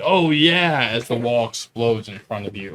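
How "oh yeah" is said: loud, higher mascot holler; booming cartoon mascot cheer; Kool-Aid Man. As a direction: burst out with a big hearty catchphrase. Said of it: "almost like a Kool-Aid man".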